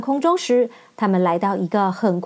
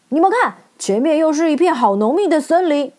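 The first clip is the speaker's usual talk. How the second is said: higher, louder call to look ahead; 6 semitones higher, 3 dB louder than usual. Acted. Scarecrow calls the others to look ahead, higher and louder. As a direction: point and call out. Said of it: "稻草人忽然指着前方大声说".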